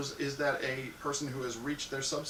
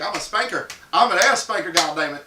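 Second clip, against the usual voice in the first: mock bravado; 3 semitones higher, 10 dB louder than usual. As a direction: puff up and crow it. Spanker boasts mid-action.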